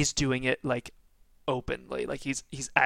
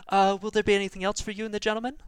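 bright, quick, higher waitress voice; 8 semitones higher, 4 dB louder, much faster than usual. Acted. GM voicing the waitress asking the player about anything else, quick and high. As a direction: chirp it out fast like a busy waitress.

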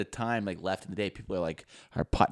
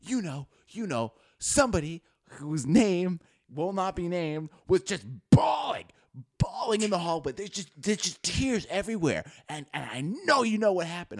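retold scandalized rant, pitch way up; going off; 7 semitones higher, 4 dB louder than usual. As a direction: imitate an upset friend, building to a high loud peak. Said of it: "she just fucking going off".

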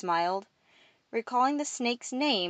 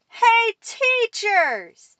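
very slow, high, stretched yell; shouted call; 9 dB louder, much slower than usual. A narrator's reading. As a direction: holler across at the teachers. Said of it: "Alfred resumed control".

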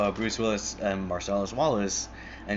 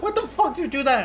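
high, fast, loud disbelieving demand; shocked angry outburst; 4 dB louder, faster than usual. Retold or imitated. Hitman yells at partner over the shooting: high fast outburst. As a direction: shriek it high and fast at him. Partner accidentally shot a man.

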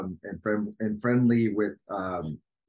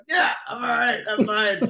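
mock tearful reassurance; 13 semitones higher, 7 dB louder than usual. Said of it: "I'm like".

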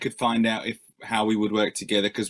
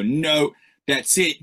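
higher, louder mock outrage; mock disgusted fan; 5 semitones higher, 3 dB louder than usual. Fan rejecting the new record, hammed-up outrage. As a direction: ham up the fed-up listener. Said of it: "surprised and disgusted ... and go".

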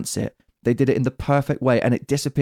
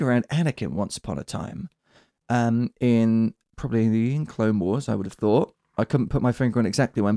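ordinary talk

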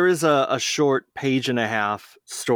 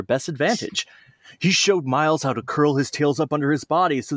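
lively read-aloud storyteller voice; storybook narration; 4 semitones higher than usual. Acted. Friends on a podcast hear a fan story read aloud, lively storybook narration. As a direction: tell it like a bedtime story for kids.

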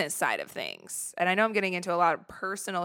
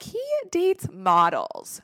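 higher, slow, disbelieving; incredulous; 11 semitones higher, 4 dB louder, slower than usual. Retold or imitated. Doubting she could date Nick, a higher scoffing voice. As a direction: scoff at your own chances. Self-doubt about the crush.

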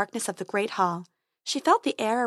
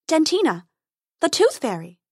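fairy voice; 6 semitones higher, 4 dB louder than usual. A narrator's reading. Fairy introduces herself.

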